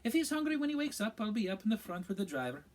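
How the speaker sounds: high, bright witch voice up top; kindly old witch. A narrator's reading. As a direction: coo at the children like a trolley witch. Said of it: "said the witch".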